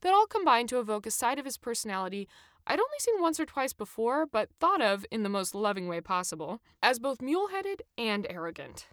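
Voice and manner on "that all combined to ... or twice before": ordinary delivery, nothing marked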